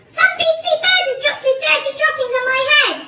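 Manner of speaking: high, loud squawk of outrage; outraged cry. A narrator's reading. Missus Twit complaining of bird droppings, outraged cry. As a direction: cry out in high-pitched disgust. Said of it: "cried Missus Twit".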